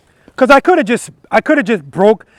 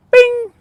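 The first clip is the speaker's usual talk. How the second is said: high bing; 3 dB louder than usual. Shown as a demonstration. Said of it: "So address it as soon as you feel it".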